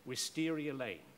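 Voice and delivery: usual speaking voice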